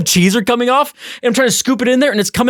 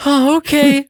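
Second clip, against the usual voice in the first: higher, bright mock compliance; a chipper put-on okay; fake cheerful okay; 7 semitones higher, slower than usual. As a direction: play along sweetly. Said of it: "So I go".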